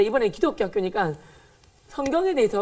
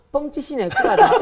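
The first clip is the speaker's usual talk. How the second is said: high, loud laughing scoff; mocking laugh, rising loud; laughing mockery; 5 semitones higher, 5 dB louder, slower than usual. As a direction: scoff at the sermon through laughter. Mocking note while writing.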